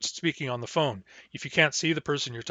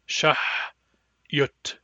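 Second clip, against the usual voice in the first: slow careful. Shown as a demonstration. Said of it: "slowly and carefully".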